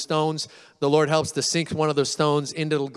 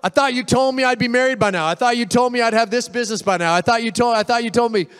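high, loud complaint to the Lord; mock-indignant whine at God; upset with God; 7 semitones higher, 4 dB louder than usual. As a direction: protest in a raised, pleading pitch, piling up the grievance. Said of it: "we can get upset with God".

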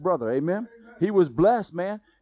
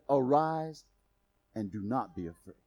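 tender Jesus; 7 semitones lower, 5 dB quieter than usual. Acted. Jesus voiced tenderly in sermon.

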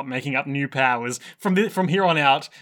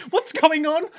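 sudden high-pitched shock; panicked, squeaky confusion; shocked squeak; 13 semitones higher, slower than usual. Retold or imitated. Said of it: "And Dio's like".

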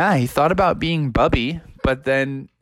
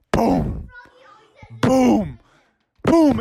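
approaching footsteps; 8 semitones higher, much slower than usual. Acted. Footsteps ripple Froggy's drink, booms building. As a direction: build each boom as it comes closer.

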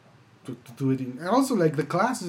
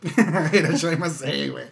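laughing; 4 dB louder than usual. Retold or imitated. Repeating joke laughing.

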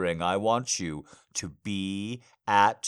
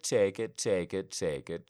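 normal talking voice

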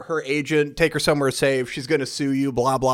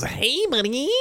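high-pitched sing-song greeting; fake-friendly demon; 11 semitones higher than usual. Demon coming up to Bryce at a bar, chummy. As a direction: lilt it up, overly chummy.

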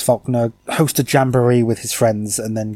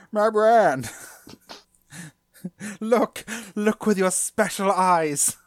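dramatic advert voice; 7 semitones higher, much slower than usual. Retold or imitated. Quoting the advert again.

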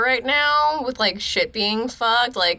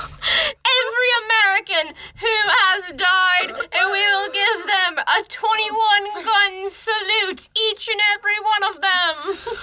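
town crier; 10 semitones higher than usual. Pretend. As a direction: announce the dead like a town crier, laughing. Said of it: "Town crier".

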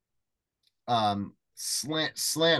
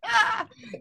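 high laugh. Real, not acted. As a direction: burst of high-pitched laughter. Reacting to the friend's quoted reply.

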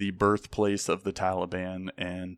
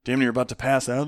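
usual speaking voice

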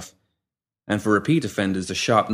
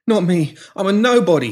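babbling; 10 semitones higher, 6 dB louder than usual. A narrator's reading. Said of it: "he babbled".

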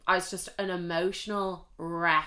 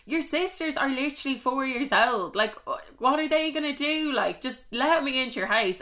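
exasperated retort; 6 semitones higher than usual. Retold or imitated. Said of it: "I'm like".

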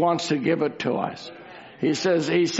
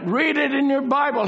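normal talking voice

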